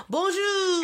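high, gushing French bonjour; mock French greeting. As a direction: trill bonjour like a cartoon Parisian.

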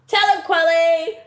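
normal talking voice

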